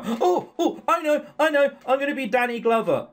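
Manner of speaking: high, eager burst of an idea; excited outburst. Acted. Nicole claiming a cop role for herself, excited. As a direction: blurt it out with delighted excitement.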